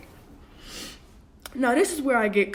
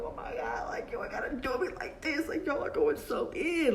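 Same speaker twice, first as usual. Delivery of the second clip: retold crying; 5 semitones higher, 3 dB quieter than usual. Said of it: "I just start crying".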